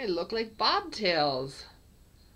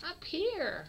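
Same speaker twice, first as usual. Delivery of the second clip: much higher call up to the kitten; 12 semitones higher than usual. Real, not acted. Calling kitten to camera.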